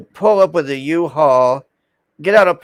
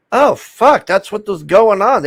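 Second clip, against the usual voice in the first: sudden higher, louder oh-fuck realization; 6 semitones higher, 4 dB louder than usual. Retold or imitated. Retelling his own sudden realization, surprised. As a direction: it suddenly dawns on you. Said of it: "and I'm like".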